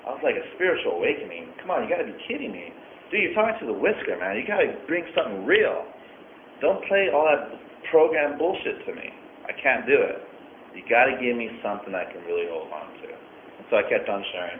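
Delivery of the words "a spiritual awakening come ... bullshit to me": high, fast mocking scoff; scoffing disbelief. Telling the sponsor off in disbelief, mocking scoff. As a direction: brush it off as a joke, higher and faster.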